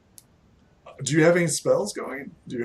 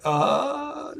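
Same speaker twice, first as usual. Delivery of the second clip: sudden high startled uh; a caught-off-guard squeak; 13 semitones higher than usual. Acted. Barmaid startled at being asked to throw him out, a high squeak.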